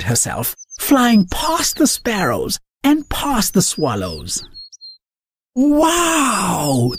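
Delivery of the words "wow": higher marvelling wow; awed wow. Acted. Sparrows admire the flying chicken, amazed. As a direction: gasp it in wonder. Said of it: "the birds would say".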